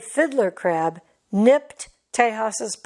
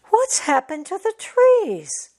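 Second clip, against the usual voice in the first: high, alarmed cub voice; a small cub seeing felled trees; shocked cub; 11 semitones higher than usual. Acted. Tejas asking his mother, high and shocked.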